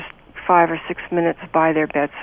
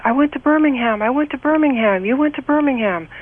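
much higher and louder, eager retelling; excited soldier; 9 semitones higher, 4 dB louder than usual. Retold or imitated. Soldier thrilled to meet a girl from his high school, excited. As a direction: bounce the words like a thrilled boy from home. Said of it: "he was so excited".

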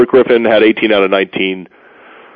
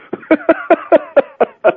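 laughing at himself. Real, not acted. Laughing at his own flub.

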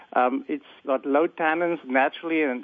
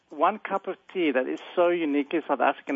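ordinary talk

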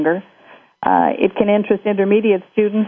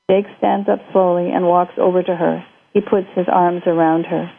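ordinary delivery

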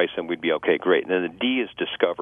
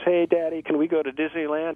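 put-on raised, pleading kid voice; child asking; 7 semitones higher than usual. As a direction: play the eager kid.

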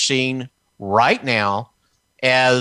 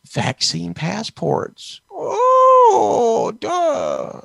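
mock horror; 11 semitones higher, much slower than usual.

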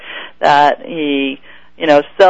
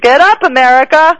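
rallying shout; 9 semitones higher, 4 dB louder than usual. Pretend. Host rallying listeners, loud.

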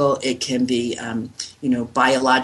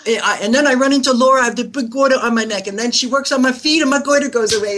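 higher, louder New York accent imitation; 8 semitones higher, 3 dB louder, faster than usual. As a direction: ham up a New York accent while retelling. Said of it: "he, in his New York accent".

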